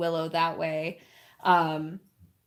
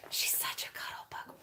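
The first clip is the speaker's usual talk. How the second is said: breathy hushed whisper, even softer; 13 dB quieter than usual. Real, not acted. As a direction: hush it to a whisper over the dozing dog. Whispering about the puppy.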